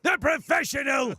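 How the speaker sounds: loud, high northern shout; northern veteran voice. Shown as a demonstration. Voice actor announcing his character in a northern shout. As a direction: announce it like a drill sergeant. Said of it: "go talks like this".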